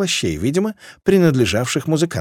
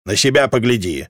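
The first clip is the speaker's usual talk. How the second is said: plain reading voice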